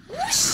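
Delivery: high swooping whoosh rising up; whoosh sound. Pretend. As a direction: sweep the voice up like a zooming board. Hoverboard jumping round corner.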